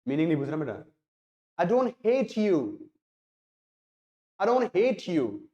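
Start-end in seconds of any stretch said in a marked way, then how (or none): 1.6-5.4 don't punched and drawn out, rest light; stress on don't. Shown as a demonstration. Teacher demoing sentence stress to students, don't punched. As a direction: lean hard on don't and stretch it. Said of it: "मेरा जो stress देना था ना, ये इस पे देना था".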